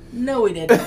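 loud, firm imitation of a Russian-accented grandmother; mock Russian grandma. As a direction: playfully imitate a Russian grandma putting her foot down. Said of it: "has a thick Russian accent".

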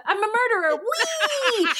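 high, squealed mock glee; hammed-up giddy villain ending in a squeal; gleeful Hamilton parody. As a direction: celebrate it with a soaring whee. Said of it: "remember that scene in Hamilton?".

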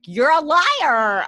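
loud, high, drawn-out accusing cry; drawn-out accusation. Acted. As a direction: call out the liar, stretching it high and loud. Mock yelling at TV character Allison.

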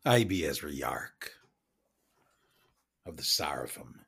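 low, soft pirate captain; pirate introducing himself. Acted. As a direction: announce the name low and unhurried, in character. Player introduces Ezra in character.

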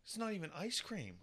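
exasperated. Real, not acted. Dad exasperated by daughter.

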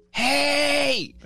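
high, long-held "hey"; drunk husband. Retold or imitated. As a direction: imitate a drunk calling to his wife. Imitating drunk husband in bed.

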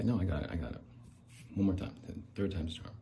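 ordinary delivery

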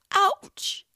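high pained ouch; a little boy yelping in pain; mild child's whimper. A narrator's reading. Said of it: "he whimpers".

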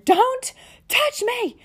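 high, drawn-out yell; shrill slow cry of don't touch me. Retold or imitated. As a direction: snap it out high and slow, fending him off. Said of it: "my classic phrase ... came flying out of my mouth".

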